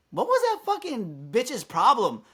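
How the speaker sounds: slow, high-pitched indignation; retold indignant question. Regular customer asking the server about the quarrel, indignant. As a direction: imitate an outraged onlooker, drawing it out.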